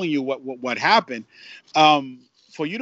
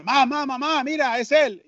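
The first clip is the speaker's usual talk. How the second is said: higher, stammering excited call; excited fan; 9 semitones higher than usual. Retold or imitated. Puerto Rican fan calling his mother over, excited and higher. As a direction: call out to mom, thrilled.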